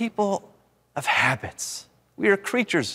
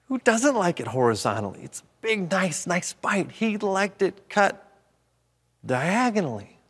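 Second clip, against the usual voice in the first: ordinary talk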